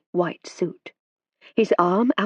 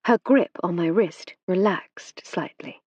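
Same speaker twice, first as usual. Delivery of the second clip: ordinary delivery, nothing marked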